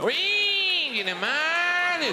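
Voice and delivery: high, very slow drawn-out Aussie moan; mock Australian whinge. Australian bloke mocked in a slow high moan. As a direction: stretch it out like a gibbon-ish Aussie. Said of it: "impersonation of a gibbon".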